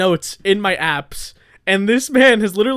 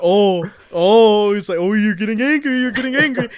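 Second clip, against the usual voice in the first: mock taunting; 4 dB louder than usual. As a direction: goad him like a tired, silly friend. Imitates friend taunting him.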